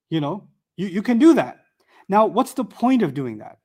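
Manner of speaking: ordinary delivery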